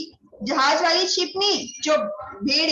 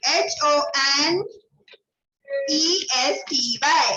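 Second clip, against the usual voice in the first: spelling out letter by letter, slowly; slow, careful dictation of letters; slower than usual. Shown as a demonstration. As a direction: dictate each letter slowly for the class to copy. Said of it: "तो इसमें आ जाएगा".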